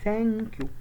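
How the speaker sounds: "ثانك يو" high, with a long open a; vowel stretched downward. Shown as a demonstration. Teacher explaining mouth shape, "ثانك يو" high with open a. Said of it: "مش بطلع لفوق".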